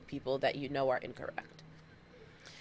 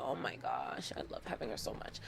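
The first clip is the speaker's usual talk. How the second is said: whispered, much lower and softer, hurried; gushing whisper; 11 dB quieter than usual. Real, not acted. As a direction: whisper it as if too cute to say aloud. Gushing about her niece.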